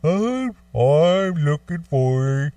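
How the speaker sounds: slow, high, put-on monster groan. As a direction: drag the words out like a lumbering monster. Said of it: "talking like Frankenstein in the Monster Mash".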